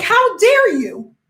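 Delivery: high, drawn-out mock indignation; indignant outrage. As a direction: act scandalized, stretching it out high. Acted indignant phone call retort.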